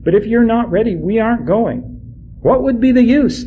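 a touch of an exasperated son; exasperated protest. Acted. Judah argues with his father.

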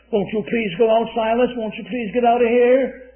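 hammed-up imploring; acted pleading. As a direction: beg them to leave, voice pushed up. Officials begging prisoners to leave.

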